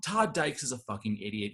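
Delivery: normal talking voice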